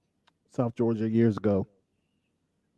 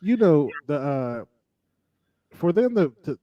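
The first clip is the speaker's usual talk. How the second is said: ordinary talk, nothing marked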